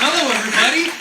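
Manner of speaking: ordinary delivery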